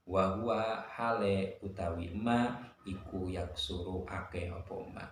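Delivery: ordinary talk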